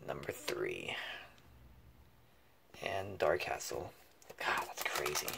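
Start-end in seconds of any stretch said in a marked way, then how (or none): 4.4-5.3 breathless whisper of disbelief; awed whisper. Real, not acted. Collector stunned by a pull, whispering in awe. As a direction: hush it like you can't believe it.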